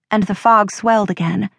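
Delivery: usual speaking voice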